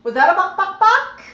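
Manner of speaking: high, loud sing-song buck buck; high squeaky coaxing to get the parrot to cluck; coaxing buck call. Shown as a demonstration. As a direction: call it up high to coax the bird.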